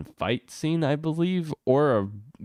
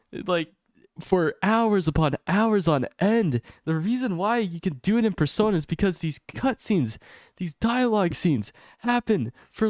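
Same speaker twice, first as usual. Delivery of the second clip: much higher, pitched-up complaint; exasperated rant; 8 semitones higher than usual. Real, not acted. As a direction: push it up high in frustrated disbelief. Ranting about dialogue length.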